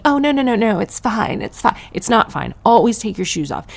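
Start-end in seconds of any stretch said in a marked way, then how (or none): 0.0-1.8 fluster politely like an awkward Brit; mock British politeness. Comic mimics a Brit waving off the shoe question, high and flustered. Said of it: "shaming me into Britishness by going".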